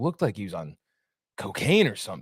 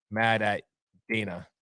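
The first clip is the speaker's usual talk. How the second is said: ordinary delivery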